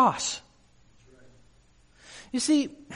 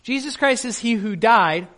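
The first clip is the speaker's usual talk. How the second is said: ordinary delivery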